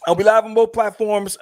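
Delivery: normal talking voice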